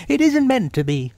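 high, disguised rabbit voice. Acted. Rabbit keeping up his disguise, high character voice. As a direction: deny it in a high put-on voice. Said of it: "said Rabbit".

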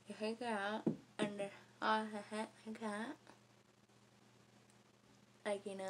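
talking while flossing teeth; speaking with hand at lips; fingers and floss in mouth. Real, not acted. Seen 0: fingers hold floss between braced teeth while she talks.

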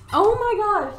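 high, loud burst of surprise; real startled cry; startled exclaim. Real, not acted. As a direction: yelp it out as the dog goes wild. Dog goes ham with toy.

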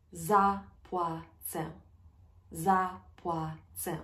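drawn out, syllable by syllable; slow clear demo; slow teaching pace. Shown as a demonstration. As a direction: stretch it out slowly for learners. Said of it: "slowed teaching repeat".

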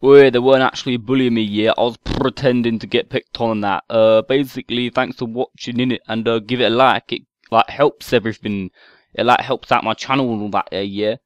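exaggerated London chav accent, mouthy; whiny London chav accent, picking a fight; put-on London chav accent. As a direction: play a stroppy London chav kid on a game mic. Chav troll persona.

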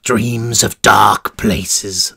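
low, slow menacing jailer; sinister warden. Acted. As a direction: purr it like a cruel warden. Warden voice continues.

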